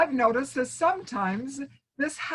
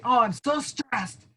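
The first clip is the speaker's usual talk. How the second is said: mock stressed complaint. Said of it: "people say".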